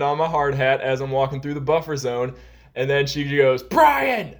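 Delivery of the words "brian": calling a name out high and loud; high, loud warning shout; sharp yell. Retold or imitated. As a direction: snap his name across the room. Coworker yelling his name across the floor.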